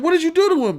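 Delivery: comic high-voiced buddy; high mock friend voice. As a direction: squeak it out like a startled inmate. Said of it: "then they said".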